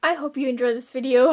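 normal talking voice

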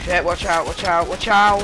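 urgent loud warning cry; alarmed shout. Real, not acted. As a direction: yell the warning, panicked. Warning teammate of attacking enemy.

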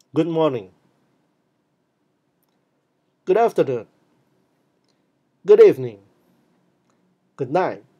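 loud, clear model for repeating; energetic model greetings. Shown as a demonstration. Teacher modelling greetings for class to repeat, loud and clear. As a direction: say it out for the class to echo. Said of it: "Please repeat after me".

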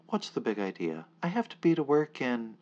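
rising indignant call. Acted. Narrator demands answer from men.

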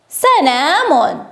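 very slow, syllable by syllable; slow sounding-out. Shown as a demonstration. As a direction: stretch the word so each sound stands out. Teacher sounds out written word.

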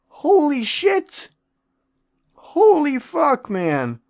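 very high, slow squeal of disbelief; awed exclaim. Real, not acted. Gamer gawks at the huge number-four player, a high awed squeal. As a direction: stretch it out up high in shock.

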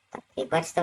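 normal talking voice

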